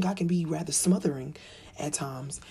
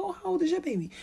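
polite small talk; 11 semitones higher, faster than usual. Retold or imitated. Herself asking back brightly.

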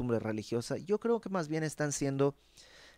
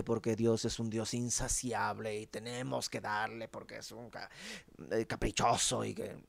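sarcastic tone; 2 semitones lower than usual. Retold or imitated. The people taunting God over his demands, sarcastic and mocking. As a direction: taunt God with exaggerated offers. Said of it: "te das cuenta el tono".

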